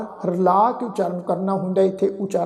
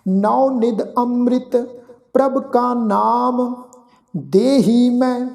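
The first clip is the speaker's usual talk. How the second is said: slow, measured recitation of sacred verse; slow scripture recitation; 3 semitones higher, slower than usual. Acted. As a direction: lay each phrase down slowly with pauses. Recites gurbani verse slowly.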